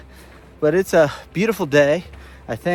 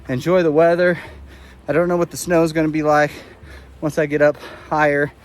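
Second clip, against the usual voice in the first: ordinary talk, nothing marked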